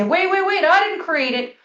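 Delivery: imitated indignant denial; mock protest. Voices an objecting listener.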